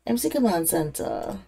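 ordinary talk, nothing marked

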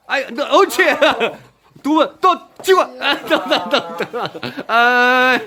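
high, laughing count of hits; laughing excitement. Real, not acted. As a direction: laugh along with each hit. Dad laughs at rally. (웃으며 신난 응원)

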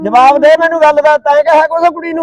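father loudly confronting the son-in-law; angry demand. Acted.

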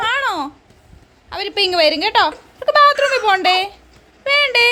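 high, loud coaxing. Real, not acted. Mother consoling baby that they will return, high baby talk.

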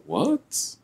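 shocked high yelp; surprised. Acted. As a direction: caught off guard, sharp. Surprised reaction.

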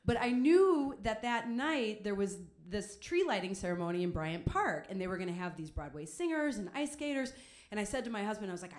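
normal talking voice

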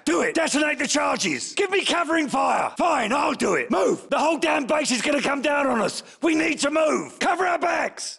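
shouted orders. Acted. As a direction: bark commands over the gunfire. Firefight, yelling orders over gunfire.

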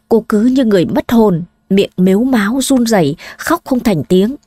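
usual speaking voice

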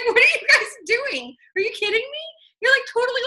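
high, indignant squeal of betrayal; outraged disbelief. Retold or imitated. Wife confronting husband about secret buying, high outraged squeal. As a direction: shriek it like you just caught them red-handed.

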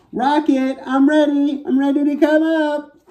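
squeaky high little astronaut voice, calling up; high character voice. Acted. Little astronaut calls up to her rocket, high and squeaky. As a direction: call to the rocket in a tiny high voice. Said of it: "You probably can't hear me very well like that".

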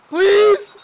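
very high, loud begging cry; desperate plea. Pretend. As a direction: beg them at full volume. Begging someone to come back.

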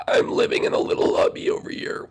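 whispered, voiceless squeak; whispery little character. Pretend. Gil answers from his little cubby, whispery voice.

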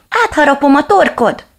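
high, loud furious yell; angry threat. Acted. Little dog threatens the reflection, high and loud. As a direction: snarl out the threat.

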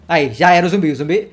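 ordinary talk, nothing marked

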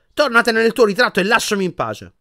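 loud, high dismissal; furious shout. A narrator's reading. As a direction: angrily chase off a pest. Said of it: "urlò Ron furibondo".

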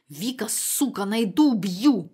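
shouted threat over the answering machine; yelled threat. A narrator's reading. Neighbour yelling a threat into the answering machine. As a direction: bellow it as a furious neighbour. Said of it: "прокричал автоответчик голосом соседа Гены".

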